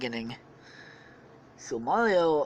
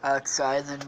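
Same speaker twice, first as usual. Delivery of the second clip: usual speaking voice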